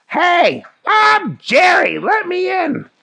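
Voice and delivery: high, loud cartoon shout; calling out. Jerry demands entry at the next boat, high shout. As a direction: holler it at top pitch.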